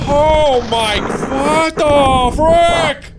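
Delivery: high slow startled yell; startled shout. Real, not acted. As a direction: drag out a shocked yell.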